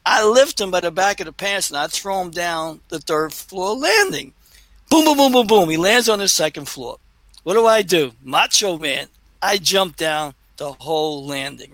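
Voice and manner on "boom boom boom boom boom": high, loud run of booms; imitated tumbling booms. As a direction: imitate a body tumbling downstairs. Imitates a fall down stairs.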